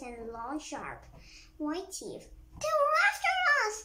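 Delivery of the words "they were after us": high, loud, fast shout of alarm; yell. A narrator's reading. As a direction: yell a warning to the others at full voice. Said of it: "yelled Chip".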